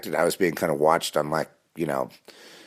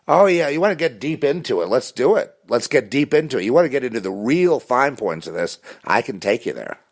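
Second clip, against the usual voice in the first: high, louder mocking taunt; the devil behind the masks, taunting and high; 8 semitones higher, 3 dB louder than usual. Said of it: "they're the devil taunting you".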